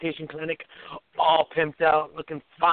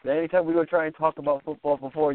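ordinary delivery

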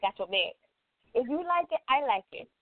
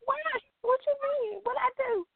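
mock innocent; 13 semitones higher than usual. Real, not acted. Feigned innocence after accusation.

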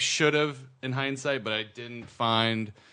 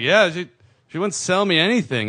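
whiny complaint; 8 semitones higher, 7 dB louder than usual. Retold or imitated. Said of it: "I was like".

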